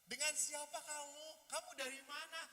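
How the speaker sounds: high, loud chatty questioning; gushing extrovert. Acted. Extrovert quizzing seatmate, high loud gushing. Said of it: "Ngomong panjang".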